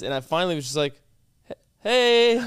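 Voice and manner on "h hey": higher, louder hesitant "hey"; pointed greeting. Retold or imitated. Girlfriend finally greeting the staring woman, high pointed greeting. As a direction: call out a mock-awkward hello.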